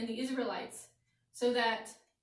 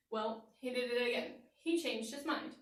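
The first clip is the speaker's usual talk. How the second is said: plain reading voice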